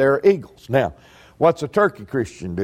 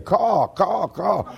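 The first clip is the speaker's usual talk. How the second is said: imitated crow caws, slightly higher; 3 semitones higher, 3 dB quieter, much slower than usual. Preacher imitates crows warning each other with caws. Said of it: "Them other crows are flying around saying".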